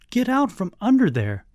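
high-pitched, embarrassed scolding; exasperated order. A narrator's reading. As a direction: order a silly uncle out, exasperated. Ordering uncle out from bed.

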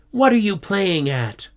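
high, indignant demand; cross, high-pitched scolding; snapping. A narrator's reading. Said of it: "she snapped".

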